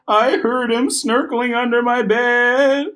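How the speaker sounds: put-on high, slow frightened child voice; boy insisting on the monster. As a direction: plead with wide-eyed fear. Scared child reports.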